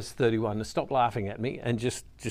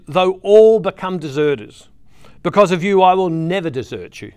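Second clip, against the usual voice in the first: loud, projected Bible reading; scripture reading; 8 dB louder than usual. Acted. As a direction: proclaim the passage to the whole congregation. Reads scripture aloud.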